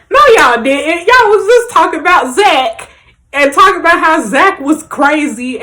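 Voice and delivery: loud, high-pitched indignant protest; worked up. Real, not acted. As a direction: call them out, getting heated. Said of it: "not even gonna get my blood pressure up".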